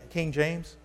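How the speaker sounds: ordinary talk, nothing marked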